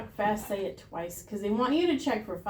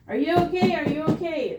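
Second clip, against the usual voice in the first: high, raised call, easing on the repeat; loud check; 6 semitones higher, 4 dB louder than usual. Shown as a demonstration. Teacher calls to an unresponsive mannequin, loud. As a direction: try to rouse someone who is not responding. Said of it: "we're gonna be loud".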